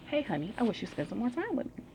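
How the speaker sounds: a hint of a soft pleading wife; wife's gentle plea. Second wife asks same.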